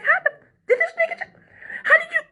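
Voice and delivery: squeaky, stammering astonishment; mock disbelief. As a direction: imitate stunned dancers, voice shooting very high. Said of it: "they was like".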